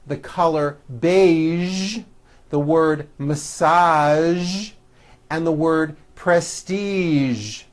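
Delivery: "beige", "massage", "prestige" ending in a held buzzing zh; teacher modelling words; final zh words. Shown as a demonstration. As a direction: lean on the final zh. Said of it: "voiced zh sound at the end".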